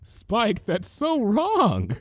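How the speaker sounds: slow prim reproach, holding back a laugh; prim scolding. A narrator's reading. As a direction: scold him primly. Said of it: "Twilight said primly".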